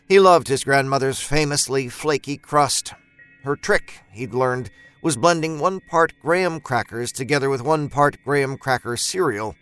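ordinary delivery

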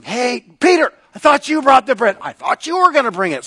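high, loud mock accusation; bickering. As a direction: squabble loudly, blaming a friend. Acted squabble among disciples.

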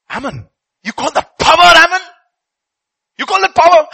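high, loud, almost crying challenge; incredulous outcry. Retold or imitated. Schindler challenging the camp commander, crying out. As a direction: cry out in disbelief. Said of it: "Schindler looks at him and he says".

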